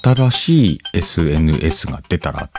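usual speaking voice

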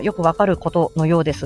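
ordinary delivery

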